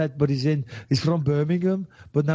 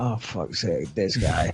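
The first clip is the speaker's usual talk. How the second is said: muttered dread at a bad referee; exasperated groan; 10 semitones lower, slower than usual. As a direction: sigh it out, fed up with the ref. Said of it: "Sometimes you're like".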